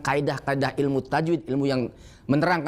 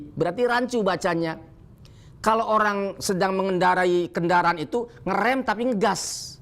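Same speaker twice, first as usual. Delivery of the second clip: normal talking voice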